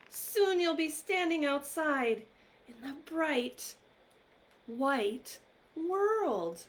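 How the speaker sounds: story-time reading voice, bright and eager; reading to children. Acted. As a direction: read it with wonder for little ones. Reads picture book aloud.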